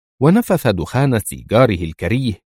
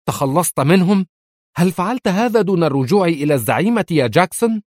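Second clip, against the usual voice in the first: higher, sharp questioning of a rival; mild shout; 9 semitones higher than usual. A narrator's reading. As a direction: confront him, voice raised. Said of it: "وهي تصرخ في وجهه". (صراخ خفيف في وجهه)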